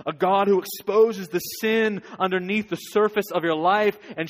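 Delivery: preacher urging the congregation to fall before God; preaching at full force. Real, not acted. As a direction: drive it home to the congregation.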